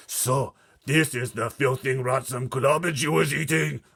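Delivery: slow loud booming disgust; booming giant. Acted. Blood Bottler holding up the Snoscumber, booming. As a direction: boom it in disgust. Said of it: "boomed the Blood Bottler".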